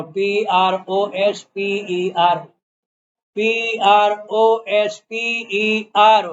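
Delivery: very slow, evenly spaced letters; spelling out. Real, not acted. Teacher spelling Prospero for students writing, slow spelling. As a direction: spell it letter by letter for note-takers.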